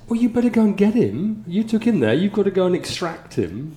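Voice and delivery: high, loud imitated scolding; urgent telling-off. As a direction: order him off in exasperation. Colleagues order him back to pub.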